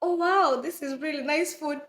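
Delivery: melodramatic half-sob, missing home; mock tearful. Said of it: "I was just like".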